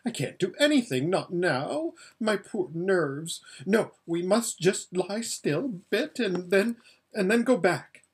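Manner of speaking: high, fluttering voice of a pampered, scared lady; frightened Lasaraleen. Acted. Lasaraleen begs Aravis to feel her shaking, high and scared.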